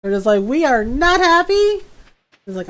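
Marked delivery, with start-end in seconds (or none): 0.4-1.8 high, loud indignant animals; mock displeasure. Displeased animals telling off the farmer, loud mock indignation. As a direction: complain high and loud, hammed up. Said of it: "they are most displeased with me".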